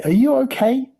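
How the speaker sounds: very high, loud worried question; anxious cry. A narrator's reading. Mother checking on her son, anxious cry. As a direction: pitch shoots up with worry. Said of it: "cried Missus T V".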